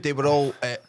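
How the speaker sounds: ordinary talk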